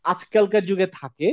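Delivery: usual speaking voice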